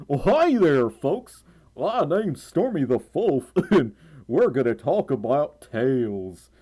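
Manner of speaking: slow, hammed-up southern drawl; southern show-host greeting, stretched out and playful; exaggerated southern twang. Shown as a demonstration. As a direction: overdo a folksy southern welcome. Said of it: "I can do a southern twang".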